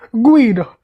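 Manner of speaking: high, loud cry of a name; cried out. Acted. Luigi telling Sally who is sick, cried out. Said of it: "Luigi cried".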